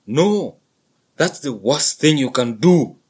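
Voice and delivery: loud, firm father bull; stern buffalo father. A narrator's reading. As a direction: warn the calf firmly. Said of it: "said the large male".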